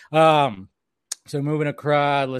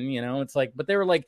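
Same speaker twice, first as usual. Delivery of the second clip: ordinary talk, nothing marked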